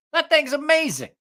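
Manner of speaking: high, loud gush of delight; excited. Real, not acted. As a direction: rave about it, voice leaping up. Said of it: "That thing is amazing".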